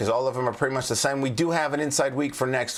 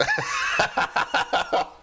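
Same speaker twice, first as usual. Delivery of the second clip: cracks up at his own bit; high laugh. Real, not acted. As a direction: laugh hard.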